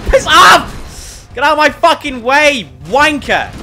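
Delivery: high, loud furious shouting. Real, not acted. Gamer shouting at enemies in his way, furious and high. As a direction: scream it at the enemies blocking you.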